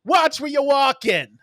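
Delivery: loud slow New York street voice telling someone off; New York accent. Retold or imitated. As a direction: bark it like a tough New Yorker. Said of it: "in my most New Yorker voice".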